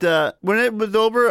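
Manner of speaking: normal talking voice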